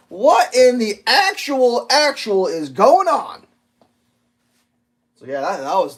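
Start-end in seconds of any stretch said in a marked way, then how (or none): 0.1-3.4 slow, louder what in the actual; bewildered at the oblivious trucker; exasperated disbelief. Real, not acted. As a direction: drag it out in exasperation. Disbelief at the driver.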